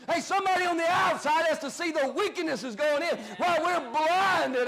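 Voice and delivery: emphatic pulpit rebuke; fired-up preaching. Real, not acted. Preacher passes on the boxer's rebuke to Americans, fired-up preaching. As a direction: challenge the congregation with ringing questions.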